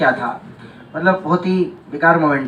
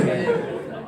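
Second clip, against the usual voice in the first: whispered punchline; 11 dB quieter than usual. Acted.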